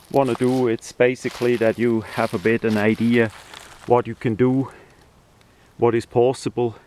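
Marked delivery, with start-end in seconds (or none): none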